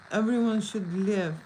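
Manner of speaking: usual speaking voice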